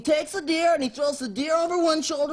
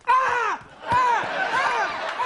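repeated high comic screams; panicked scream; 9 semitones higher, much slower than usual. Acted. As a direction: shriek in hammed-up horror at being caught. Man discovers deer on shoulder.

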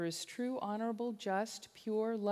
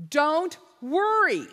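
high, loud, slow plea; loud urging; 8 semitones higher, 13 dB louder, slower than usual. Real, not acted. Preacher urging his listeners not to worry, loud and slow. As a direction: press it on them slowly.

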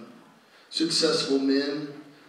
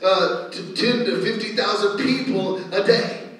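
raised, loud testimony preaching; full-force preaching; 5 semitones higher, 8 dB louder than usual. Real, not acted. Preacher telling church of a witch turned evangelist, fired up. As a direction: build to a higher, ringing proclamation.

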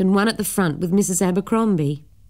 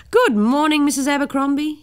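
booming greeting; 8 semitones higher than usual. Acted. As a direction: boom it out like a jolly cyclist. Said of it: "he boomed".

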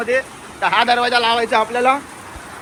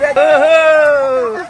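drawn-out whoop; 7 semitones higher, 5 dB louder, much slower than usual. Real, not acted.

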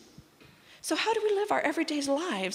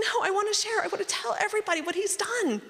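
overjoyed; 10 semitones higher, faster than usual. Retold or imitated. To her husband, bursting with joy. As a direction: insist joyfully, can't hold it in. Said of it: "I was like".

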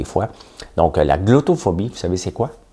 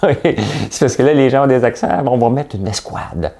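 laughing; 6 semitones higher, 3 dB louder than usual. Real, not acted. Mock mayor line, laughing.

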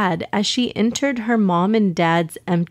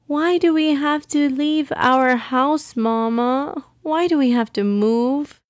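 put-on higher, plaintive child's question; sniffly child's plea; 6 semitones higher than usual. A narrator's reading. Mariam asks her mama why they must move, sad and pleading. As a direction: ask it high, like an upset little girl. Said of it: "as she sniffled".

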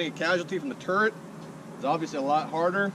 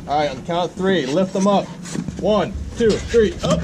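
loud count for the lift; 3 semitones higher, 9 dB louder than usual. Acted.

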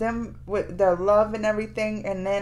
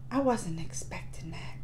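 stunned whisper; 5 semitones lower, 9 dB quieter than usual. Real, not acted. Stunned after sad ending.

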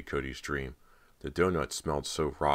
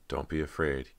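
ordinary delivery

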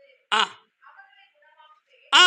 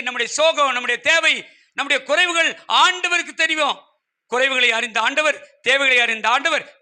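ordinary talk, nothing marked

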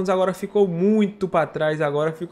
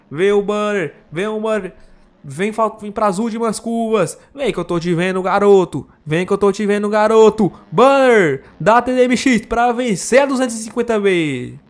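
loud, raised finish-line yell; shouting; 3 semitones higher, 8 dB louder than usual. Real, not acted.

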